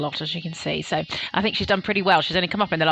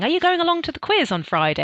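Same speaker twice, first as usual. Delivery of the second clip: chatty question; 9 semitones higher than usual. Retold or imitated. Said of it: "someone was saying".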